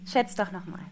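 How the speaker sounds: normal talking voice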